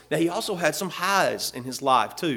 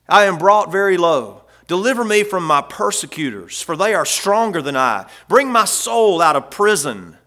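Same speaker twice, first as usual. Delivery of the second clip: higher, louder preached scripture reading; preaching the psalm; 3 semitones higher, 6 dB louder than usual. Acted. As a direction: lift the psalm up to the congregation.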